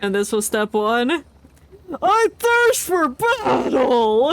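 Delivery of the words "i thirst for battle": hammed-up battle cry. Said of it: "I love shouting".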